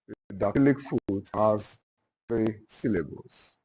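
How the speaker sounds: deliberate dictation, long pauses between phrases. Real, not acted. Teacher dictating the dactylic foot to note-takers, dictation. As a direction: dictate it in small repeated chunks for note-takers.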